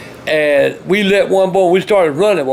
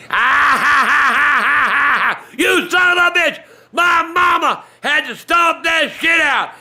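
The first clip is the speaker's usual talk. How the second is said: furious yell; 6 semitones higher than usual. Retold or imitated. Son yelling at the old prankster, furious and high. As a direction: scream at the old man, furious.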